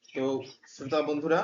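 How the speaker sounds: normal talking voice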